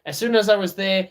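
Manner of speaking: normal talking voice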